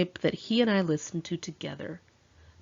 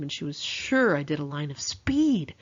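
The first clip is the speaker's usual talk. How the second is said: ordinary delivery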